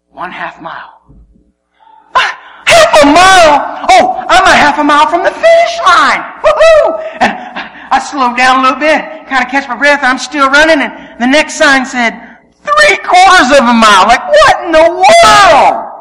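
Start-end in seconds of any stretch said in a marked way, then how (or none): 2.1-7.0 high, loud whooping celebration; elated shout. Acted. Runner seeing the half-mile sign, elated shout. 12.6-15.9 outraged disbelief. Acted. Runner reading the next sign, loud disbelief.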